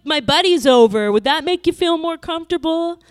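the man taunting her in the elevator, cruelly mocking. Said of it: "laughing in this kind of cruel manner".

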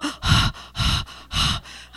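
hard, panicked panting; imitated heavy breathing. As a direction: act out hyperventilating on the phone. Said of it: "breathing really heavy".

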